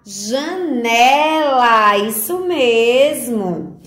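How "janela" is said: "Janela" joined up in one slow, stretched word; whole word, drawn out. Shown as a demonstration. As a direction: blend "Janela" together, lengthening the vowels without pauses. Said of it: "Vamos fazer a leitura?". ("Janela" dito inteiro, devagar, com as sílabas esticadas e ligadas)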